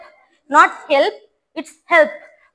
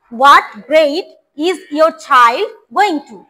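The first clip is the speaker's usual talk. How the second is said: very slow, clear drill; slow model reading; 4 dB louder, much slower than usual. Shown as a demonstration. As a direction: spell it out word by word for the class. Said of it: "আবার আরেকবার বলি". (ধীরে ধীরে স্পষ্ট করে বলা)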